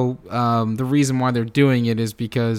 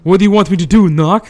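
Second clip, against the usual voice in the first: louder, higher mock exasperation; indignant retort; 5 semitones higher, 8 dB louder, faster than usual. Imitating a gunman snapping at his compatriot, indignant. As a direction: throw it back as a loud rhetorical question.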